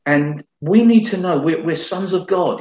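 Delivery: ordinary talk, nothing marked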